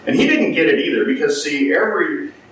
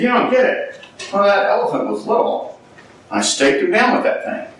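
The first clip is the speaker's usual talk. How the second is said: amused mahout; 8 semitones higher than usual. Retold or imitated.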